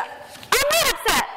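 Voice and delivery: a touch of hurt indignation in a slow high protest; defensive denial. Acted. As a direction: insist you're not upset, clearly rattled. Rosalie denies being upset, defensive.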